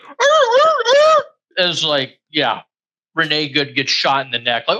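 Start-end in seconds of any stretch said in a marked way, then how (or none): 0.0-1.2 very high mocking growls; cartoon pirate-ish arr noises to mock them; mock caveman grunts. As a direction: ape a snarling mob with silly grunts. Mocking law-and-order crowd.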